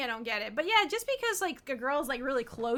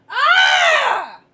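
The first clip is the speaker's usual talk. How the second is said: excited scream; 13 semitones higher, 15 dB louder than usual. Real, not acted. Squeal at cute character on screen.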